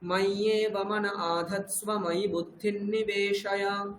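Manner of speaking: slow, deliberate Sanskrit verse; measured verse reading; slow recitation. Shown as a demonstration. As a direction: chant it slowly for the student to copy. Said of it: "teacher models the verse".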